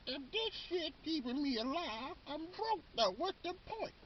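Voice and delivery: very high, soft old-person impression. Old person broke from medicine costs, exaggerated high elderly voice. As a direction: pitch it way up into a put-on elderly voice. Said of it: "old person voice".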